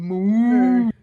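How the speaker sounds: loud, drawn-out moo; cow's moo. Acted. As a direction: moo like a cow. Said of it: "the couch goes moo".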